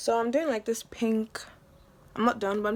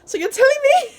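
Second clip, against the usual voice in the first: much higher and louder, outraged disbelief; betrayed disbelief; 10 dB louder than usual. Retold or imitated. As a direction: hit it like a kid who has just found out she was lied to. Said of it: "I felt betrayed".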